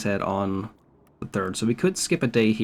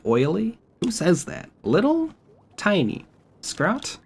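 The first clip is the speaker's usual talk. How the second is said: incredulous; 9 semitones higher, slower than usual. Real, not acted. Mocking the British insult.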